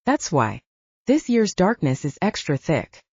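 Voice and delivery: ordinary delivery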